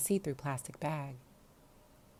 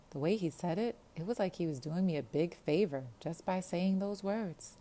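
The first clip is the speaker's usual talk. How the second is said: ordinary delivery, nothing marked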